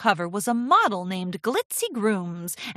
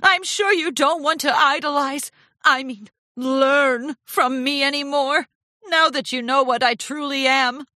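higher, theatrical martyr's lament; sniffly self-pity; 6 semitones higher, 4 dB louder than usual. A narrator's reading. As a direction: wallow grandly in shame. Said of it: "Rarity sniffed".

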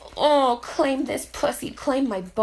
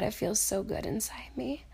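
breathy afterglow whisper; 3 semitones lower, 12 dB quieter than usual. Acted. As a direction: whisper it close and soft.

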